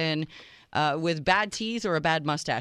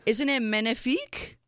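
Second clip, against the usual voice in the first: mock French; 6 semitones higher than usual. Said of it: "French romantic host banter".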